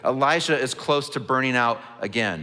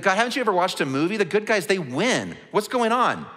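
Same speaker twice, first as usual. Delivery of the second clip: higher, fast, exasperated protest; exasperated Elijah; 6 semitones higher than usual. Retold or imitated. Elijah exasperated with God, higher and faster. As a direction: whine it at God like a wronged hero. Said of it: "It's like".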